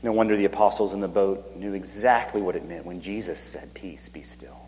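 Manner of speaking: hushed, breathy whisper. Acted. Preacher hushes the crowd as God stills the waves, whispering. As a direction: whisper as if calming the sea.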